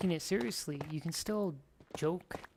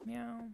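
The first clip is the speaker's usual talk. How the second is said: high soft meow; cat meow; 8 semitones higher, 5 dB quieter than usual. Pretend. Playing a cat at the animals, soft high meow. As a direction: mew like a cat, high and small.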